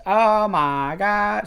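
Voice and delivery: high, very slow drawn-out wail; movie quote imitation. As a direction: stretch every word in hammed-up horror. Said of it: "saying it just like him".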